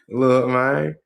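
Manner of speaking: ordinary talk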